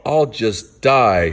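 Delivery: plain reading voice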